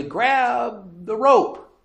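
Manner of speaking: high, drawn-out call of grab the rope. Retold or imitated. Rescuer on deck calls to the drowning man, high and drawn out. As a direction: call it across the water, slowly. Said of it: "somebody yells out to you".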